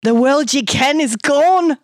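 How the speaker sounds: slow, high, dramatic Scottish pronouncement; Scottish accent. A narrator's reading. Kilted man announcing the world's end, dramatic Scottish accent. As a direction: declare doom slowly in a Scottish accent. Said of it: "he said fervently in a thick Scottish accent".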